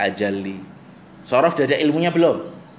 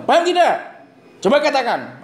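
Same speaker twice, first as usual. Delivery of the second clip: stern demo; 13 semitones higher than usual. Shown as a demonstration. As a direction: snap at a dozing schoolboy. Teacher demos stern talk to boys.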